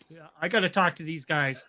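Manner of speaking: ordinary talk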